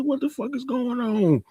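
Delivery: high-pitched, baffled imitation; mock bewilderment. Tariq confused in the row with Kane, high mock bewilderment. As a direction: demand answers in a high voice. Acted bewildered reply in quarrel.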